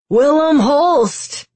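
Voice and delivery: loud call. A narrator's reading. Kaz calling out the guard's name for the roof to hear, loudly. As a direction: project it slowly up to the roof. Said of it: "Kaz said loudly".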